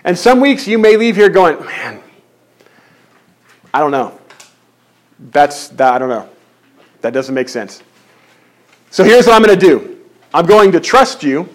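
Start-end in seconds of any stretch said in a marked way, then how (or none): 1.6-7.8 baffled mutter. Retold or imitated. Voicing a baffled congregant.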